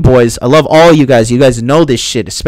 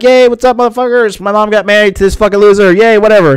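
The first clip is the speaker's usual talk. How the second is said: mock party cheer; 5 semitones higher than usual.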